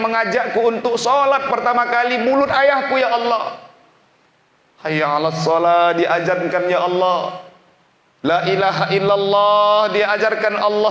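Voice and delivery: high, loud, near-tearful prayer; a preacher praying aloud for his father at full force; impassioned pleading prayer. Acted. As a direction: cry out to God at the peak of the sermon. Prayer at emotional peak.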